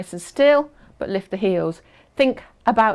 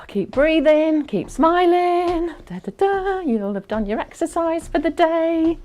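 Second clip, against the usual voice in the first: upbeat cheer; 7 semitones higher than usual. Real, not acted. Coach cheering through effort.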